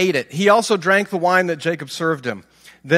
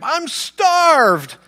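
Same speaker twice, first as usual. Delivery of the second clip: high, loud hungry wail; famished demand; 8 semitones higher, 4 dB louder than usual. Acted. Exhausted hunter demanding stew from his brother, loud famished cry.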